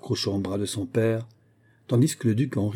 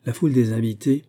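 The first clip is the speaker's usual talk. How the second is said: ordinary delivery, nothing marked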